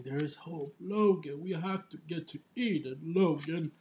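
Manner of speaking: lightly imitated old professor, imploring; a hint of an insistent elderly mentor; Charles insisting. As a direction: plead earnestly in a put-on voice. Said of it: "Charles keeps insisting".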